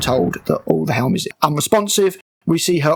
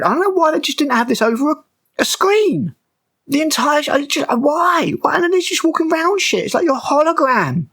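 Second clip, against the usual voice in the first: much higher, exasperated ranting; incredulous rant; 10 semitones higher than usual. Real, not acted. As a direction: rant in disbelief, voice pitched way up. Ranting at silly hologram scene.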